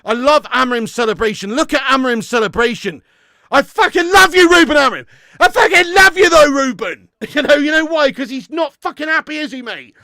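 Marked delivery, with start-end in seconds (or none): 3.5-7.1 loud, high outburst of adoration; gushing shout. Real, not acted. Fan gushing at his team's manager, excited shout. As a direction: shout your love at him.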